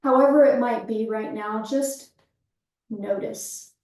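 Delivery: calm breath-awareness cueing, easing down at phrase ends; guided meditation. Real, not acted. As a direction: guide them gently into the breath, leaving space after each phrase.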